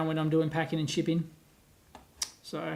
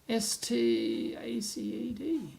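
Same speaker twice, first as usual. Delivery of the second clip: slow, letter-by-letter, softer; spelling out slowly; 6 dB quieter, much slower than usual. Real, not acted. Spelling out a name while typing.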